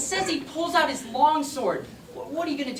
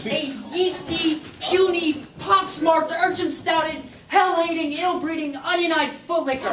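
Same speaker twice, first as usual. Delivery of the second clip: higher, mounting theatrical abuse; building insult tirade; 4 semitones higher than usual. Acted. Playwright heaping insults on his rival, mounting tirade. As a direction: pile up the insults, rising in force.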